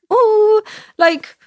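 high, cooing imitation of a doting owner; mock gushing over a little dog; put-on adoring pet-owner voice. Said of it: "they're like".

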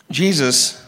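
normal talking voice

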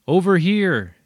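loud, high shout; yelling. Acted. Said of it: "he yells".

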